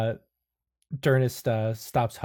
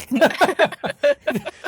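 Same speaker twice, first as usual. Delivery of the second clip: a sudden, much higher, louder laugh; high burst of laughter; 8 dB louder than usual. Real, not acted. GM cracking up at the table, loud high laugh. GM laughs at player's arm notes.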